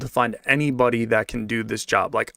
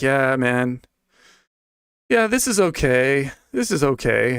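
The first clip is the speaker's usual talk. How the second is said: imitating the boss. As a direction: play the boss shrugging it off. Boss's voice in retold chat.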